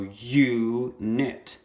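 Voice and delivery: "unit" as two beats, you, nit; split into syllables. Shown as a demonstration. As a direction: hold the U of "unit" apart from nit. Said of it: "U is the syllable by itself".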